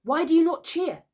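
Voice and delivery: high, loud demand; hot, indignant. A narrator's reading. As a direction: challenge them for not welcoming you. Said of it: "he said hotly".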